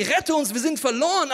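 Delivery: high, loud plea for rescue; desperate shout. A narrator's reading. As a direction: shout it out like drowning disciples. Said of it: "schrien sie". (verzweifelter Hilfeschrei)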